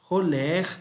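ordinary talk

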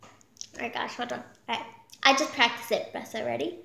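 ordinary delivery

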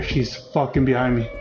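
ordinary delivery

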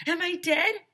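high, loud bewildered question; high loud stunned kid; dazed child. A narrator's reading. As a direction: ask dazed after waking. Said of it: "I asked".

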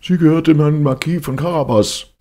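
high, loud, scared chorus of peasants; frightened mowers in chorus. Acted. Frightened mowers answer the king in one high, loud chorus. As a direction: blurt it out together in fear of the cat. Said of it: "sagten alle mitsammen".

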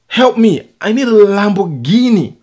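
loud, high-pitched mock cry for help; imitated yelling. Vlogger mimics the man who kicked Arnold Schwarzenegger, yelling. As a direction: yell it out, mimicking the man's desperate plea. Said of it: "He was yelling".

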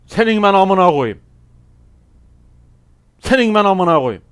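loud, high outburst that falls away; angry cursing. Real, not acted. Gamer cursing his opponent, loud angry outburst. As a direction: curse out the enemy who got you.

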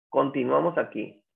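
usual speaking voice

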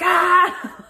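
short, high, loud mock scream; reenacted panicked shriek; imitated scream. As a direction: yell "ah" into the mic, half laughing. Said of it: "screaming into the microphone".

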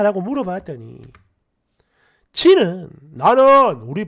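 animated story-channel reading; comment read-aloud. Acted. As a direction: read out indignant online replies. Reads listener comments aloud.

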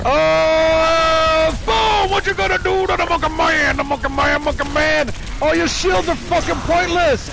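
loud, high gamer hype, crowing about the monkey man; hyped shouting. Real, not acted. Player crowing at enemies as he wins, a loud high hype shout. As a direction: yell it like a victory chant.

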